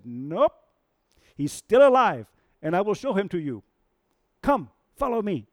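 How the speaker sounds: high, loud, eager monkey voice; excited monkey. Retold or imitated. Monkey urging Simba to follow, high and excited. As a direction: urge him along, bursting with news.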